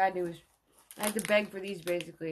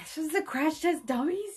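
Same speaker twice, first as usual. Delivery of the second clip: incredulous; 9 semitones higher, 3 dB louder than usual. Retold or imitated. Disbelief on hearing the song.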